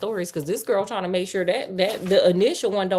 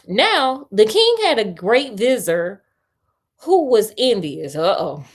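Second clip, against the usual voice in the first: storyteller reading aloud, a little louder; story narration; 2 semitones higher, 4 dB louder than usual. A narrator's reading. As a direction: read the tale to a friend, a touch dramatic. Reads the tale aloud.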